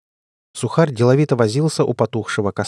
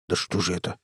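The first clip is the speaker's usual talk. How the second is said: low, soft, fretful mumble; fussy muttering; 5 semitones lower, 10 dB quieter than usual. A narrator's reading. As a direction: mutter it fretfully under the breath. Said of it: "суетливо забормотал Сухарь". (суетливое бормотание)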